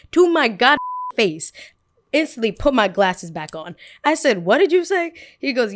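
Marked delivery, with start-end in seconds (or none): 4.5-5.1 high, loud indignant question; indignant demand. Retold or imitated. Girl confronting the boy who insulted her, high loud indignant demand. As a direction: demand he repeat it.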